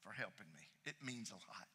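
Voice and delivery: soft grateful dad. Retold or imitated. Dad softly thanking his child after help.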